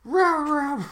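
high-pitched mock mob grumbling; angry South Park mob. Host echoing the South Park mob, mock-outraged. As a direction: join in as a cartoon rabble. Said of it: "the angry mob from South Park".

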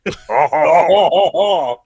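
hammed-up French "haw haw haw"; mock French villain laugh. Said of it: "if the villains were French".

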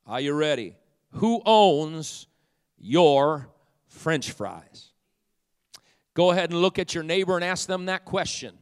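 mock-grand pulpit oratory; full-force preaching. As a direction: drive the question home to the congregation.

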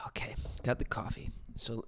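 whispered aside. Real, not acted. Host whispers an aside while getting coffee. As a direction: breathe it out quietly, no voice.

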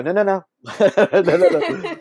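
high, loud string of denials; laughing, insistent reassurance; hasty reassurance. Retold or imitated. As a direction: rush to head off their alarm. Hurries to reassure alarmed listeners.

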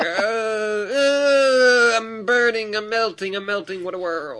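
high, wailing fake death throes; mock agony. Fake henchman acting out sinking into lava, hammed-up agony. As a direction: ham up a slow death, starting high and sinking. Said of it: "act like they were in incredible agony".

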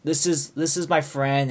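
imitated appeasing interjection, easing down toward the end; trying to placate. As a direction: call out and smooth things over. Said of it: "trying to, like, calm the situation".

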